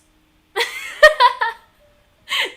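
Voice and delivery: loud, high cackle; gleeful laugh. Real, not acted. Laughing at owning many knives, gleeful loud cackle.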